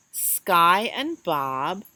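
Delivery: plain reading voice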